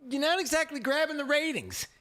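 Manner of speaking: high, mock-casual boss letting her down; lightly hammed exec voice; imitating Comcast execs. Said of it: "Comcast was like".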